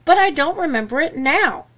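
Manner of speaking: high and loud, defending himself; defensive. Acted. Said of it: "he said defensively".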